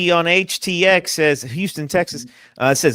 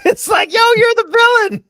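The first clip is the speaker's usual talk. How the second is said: high, laughing exclamation; excited laughing shout; 3 dB louder, faster than usual. Real, not acted. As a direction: burst out laughing, pitched way up. Laughing outburst about the movie.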